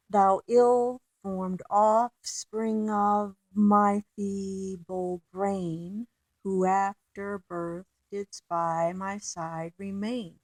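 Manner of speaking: slow reading stressing each iamb; "Thou ill-formed offspring of my feeble brain" read slowly, beat on every second syllable; march through the line in even iambs; scanned iambic reading. Shown as a demonstration. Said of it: "you don't normally in speech emphasize".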